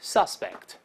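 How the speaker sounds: "suspect" as an adjective, sus- stressed; adjective, stress on first syllable. Shown as a demonstration. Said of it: "我们大声再读一下". (形容词suspect，重音在前)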